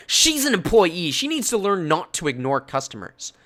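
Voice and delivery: imitated pissed-off shopper; angry customer. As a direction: insist with contempt. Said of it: "Angry lady pissed off".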